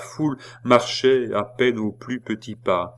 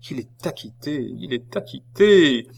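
discouraged; 5 semitones higher, 5 dB louder than usual. Acted. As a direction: rise from dread to despair. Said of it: "avec tant de découragement".

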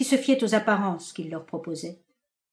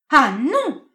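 sharp, loud, much higher cry of refusal; violent cry; 6 semitones higher, 9 dB louder than usual. Acted. Marinette refusing her dismissal, violent loud cry. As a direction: fling out a furious no. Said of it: "cria-t-elle avec violence".

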